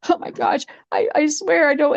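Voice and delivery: high-pitched acted panic; panicked. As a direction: plead high, like a scared girl. Girl flees in panic.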